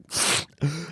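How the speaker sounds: sharp soft gasp of alarm; startled gasp. Retold or imitated. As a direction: gasp as the phone rings. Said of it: "And I'm like".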